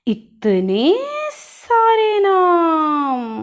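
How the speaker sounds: very slow, high, drawn-out wail; overwhelmed amazement. Acted. Pupil hears a long list of horse synonyms, overwhelmed amazement. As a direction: stretch every word out, trailing into a long whine.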